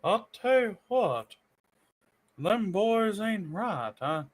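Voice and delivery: high, slow country drawl; hillbilly old man. Acted. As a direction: play a grumbling backwoods old-timer.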